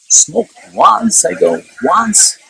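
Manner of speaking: high incredulous repeat. Retold or imitated. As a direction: throw his words back at him, disbelieving. Father questions son's claim.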